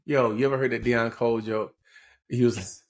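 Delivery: normal talking voice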